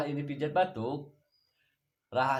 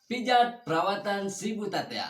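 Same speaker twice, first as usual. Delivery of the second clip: presenter intro; 7 semitones higher, 6 dB louder than usual. Acted. Channel intro greeting.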